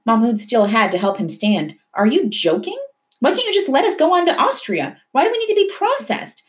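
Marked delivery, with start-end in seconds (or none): 1.9-6.4 high, furious questioning; angry outburst. A narrator's reading. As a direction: rage at the officer. Father rages at the officer.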